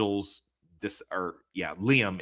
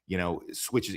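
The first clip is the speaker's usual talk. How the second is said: normal talking voice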